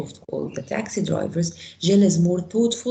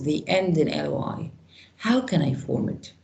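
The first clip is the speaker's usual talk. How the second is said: normal talking voice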